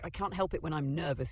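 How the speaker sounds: plain reading voice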